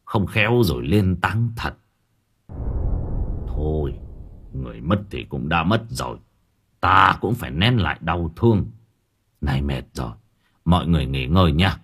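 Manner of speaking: low, softened voice of an elder; gentle old man. A narrator's reading. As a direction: counsel the family gently and gravely. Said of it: "Ông cụ Thân nhẹ nhàng bảo".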